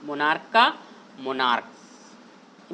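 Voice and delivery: मोनार्क slow and drawn out, ending in a hard क; pron. Shown as a demonstration. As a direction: say मोनार्क slowly with क at the end. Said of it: "यहाँ पे ये लिखा गया है मोनार्क". (मोनार्क को धीरे से, अंत में क बोलकर)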